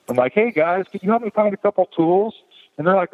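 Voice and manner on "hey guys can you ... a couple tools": much higher, chirpy everything-is-fine voice; breezy casual. Retold or imitated. Bleeding customer asking staff for tools, falsely breezy. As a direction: play it as if nothing is wrong.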